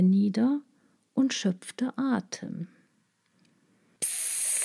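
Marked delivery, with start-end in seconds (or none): 4.0-4.5 soft unvoiced snake hiss; hissing psst. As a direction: hiss softly to lure her. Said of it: "zischte die Schlange".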